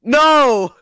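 loud, high drawn-out no; mock outcry. Friend protesting amid the group's laughter, loud mock outcry. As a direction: cry it out in playful horror.

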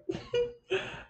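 sudden high-pitched laugh; high burst of laughter. Real, not acted. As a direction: laugh out at yourself. Laughs at herself.

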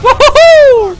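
high, loud triumphant whoop; whooping cheer. Real, not acted. Player celebrating a clear shot, triumphant whoop. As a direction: let out a victorious woohoo.

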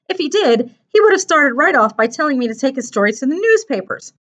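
put-on high young character reasoning aloud; earnest boy character, explaining. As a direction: lay out the worry as an animated boy. Narrator voices Mark.

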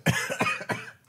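breathy high giggle; high laugh. Real, not acted. Laughing at friend's reaction.

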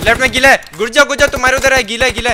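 loud, urgent callout of enemies; urgent shout. Real, not acted. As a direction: shout the warning to your squad.

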